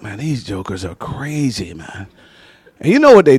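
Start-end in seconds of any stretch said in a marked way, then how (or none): 0.0-2.1 low, soft mutter; under-breath grumble. Retold or imitated. Tori muttering about his friends, low soft grumble. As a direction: grumble so they can't hear.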